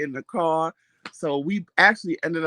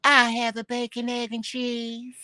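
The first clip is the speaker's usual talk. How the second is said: higher, slow sweet voice; light sweet order; 6 semitones higher than usual. Retold or imitated. Husband replays his own order in a light voice. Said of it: "All I said was".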